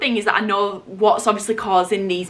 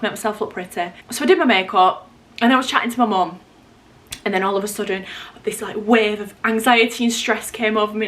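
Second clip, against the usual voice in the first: ordinary delivery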